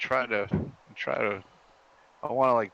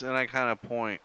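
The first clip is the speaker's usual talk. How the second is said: ordinary talk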